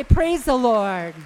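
loud, slow preacher call; praise call. Real, not acted. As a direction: lead the crowd in praise. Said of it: "Somebody say".

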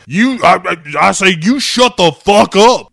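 hammed-up angry stammer; blustering mock outburst. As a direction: bellow it like an indignant boss shutting someone up. Acting gruff boss telling man off.

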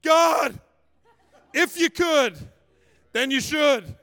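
high-pitched mock plea to God; pleading heavenward, phrase by phrase; desperate prayer. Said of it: "I just prayed this prayer".

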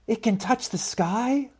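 high, soft, rising wonder; hushed little-seed amazement; awed wonder. A narrator's reading. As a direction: marvel in a small high voice. Louise awed by the mountain.

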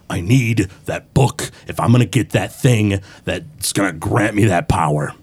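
low, menacing villain giving orders; gruff boss voice. Acted. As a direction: order the henchman in a low, gruff voice, slowing at the end. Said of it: "gruff voice".

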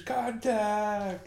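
held-back mock movie yell; imitated scream. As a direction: mimic a soldier raising the alarm. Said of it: "Aye, he screams".

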